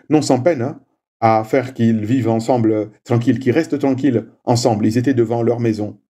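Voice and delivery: ordinary talk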